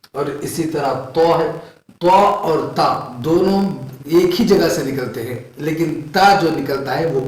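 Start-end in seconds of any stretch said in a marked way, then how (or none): none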